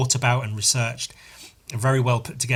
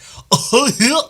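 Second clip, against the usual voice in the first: high laugh; 12 semitones higher, 8 dB louder than usual. Real, not acted. Laugh during mockery.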